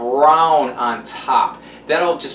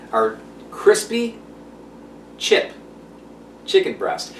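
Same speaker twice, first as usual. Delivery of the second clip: slow, careful crispy chip chicken breast, each word spaced out; careful tongue-twister delivery; said slowly; 2 semitones higher, 5 dB quieter than usual. Shown as a demonstration. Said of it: "I have to say it slow because it's a tongue twister".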